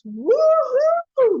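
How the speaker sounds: high excited woo-hoo; admiring whoop. Real, not acted. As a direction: whoop at how dapper he looks. Admiring Sonny's new look.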